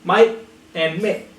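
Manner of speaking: slow "Mate and mate", first "mite", then "mek"; English then Scottish mate. Shown as a demonstration. Teacher leading repetition, slow "Mate and mate" as "mite and mek". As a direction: contrast the two vowels in "Mate and mate". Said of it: "say it with me".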